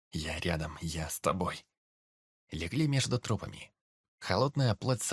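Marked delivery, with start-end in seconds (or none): 0.1-1.6 soft reassuring. Acted. Calming trembling brother.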